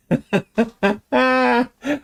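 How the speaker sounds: high staccato chuckle; real laugh. Real, not acted. Host spots a friend in chat, delighted laugh. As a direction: burst into a high, rapid chuckle.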